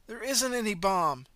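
slow, high, loud frantic insisting; desperate insistence. Acted. Runner insists to the driver, desperate. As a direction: insist in panic.

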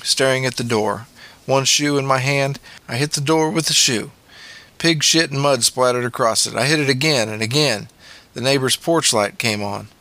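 plain reading voice

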